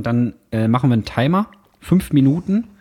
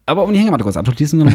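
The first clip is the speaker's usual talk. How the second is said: fast, hurried mimic of partner; imitating fast rush; 3 semitones higher, 3 dB louder, much faster than usual. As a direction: rush it to wrap up the story. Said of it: "Und dann sagst du".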